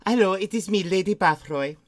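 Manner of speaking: thick put-on Eastern European accent; Lady Bathory. Lady Bathory protesting to Stephen, Eastern European accent. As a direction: plead your case in a hammy Eastern European accent. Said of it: "I don't know why I sound like Vera Farmiga".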